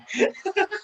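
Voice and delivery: squeaky giggle; short high laugh; high-pitched laugh. Real, not acted. Laughing at own impression.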